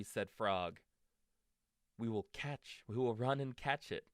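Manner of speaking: gentle storybook read-aloud; reading to children. A narrator's reading. Parent reading a bedtime story to a child, gentle.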